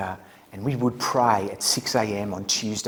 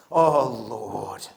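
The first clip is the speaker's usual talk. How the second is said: very slow, louder drawn-out prayer; slow fervent prayer; 9 dB louder, much slower than usual. Retold or imitated. Albie praying to the group, slow fervent intoning. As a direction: stretch each word like a fervent pray-er. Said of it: "he goes".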